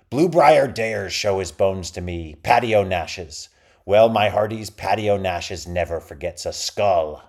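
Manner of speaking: loud, slow, booming pirate; pirate captain. A narrator's reading. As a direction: taunt like a swaggering buccaneer. Rival pirate captain taunting.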